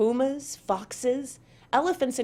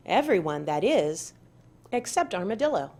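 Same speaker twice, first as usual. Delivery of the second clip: ordinary delivery, nothing marked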